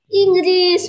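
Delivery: loud emphasis. Real, not acted. Emphatic teacher point.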